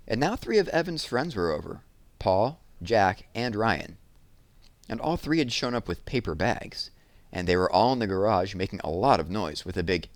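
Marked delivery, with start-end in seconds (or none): none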